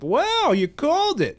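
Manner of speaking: very high-pitched imitation of amazed friends; exaggerated wonder; mock amazement. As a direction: play an impressed bystander. Mimics friends amazed by his prediction.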